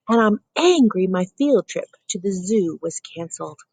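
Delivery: plain reading voice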